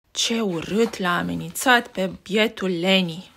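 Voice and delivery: ordinary delivery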